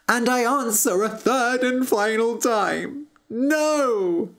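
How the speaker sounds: put-on high-pitched, resolute young prince; storybook prince standing firm; defiant prince. A narrator's reading. Prince defies the witch.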